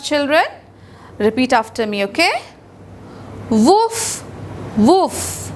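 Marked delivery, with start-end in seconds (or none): 3.5-5.5 dog bark. Shown as a demonstration. Teacher voicing the dog.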